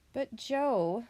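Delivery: mildly exasperated, drawn-out address; a worried mother's sigh on the name. A narrator's reading. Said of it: "Mother Possum said, sighing".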